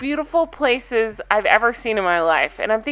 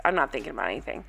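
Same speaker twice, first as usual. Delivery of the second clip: much lower and softer, mocking flat repeat; 10 semitones lower, 10 dB quieter, much faster than usual. Mocking Stephanie's words to viewers, low and soft. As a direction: echo her words under your breath with scorn. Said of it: "That's literally what she said".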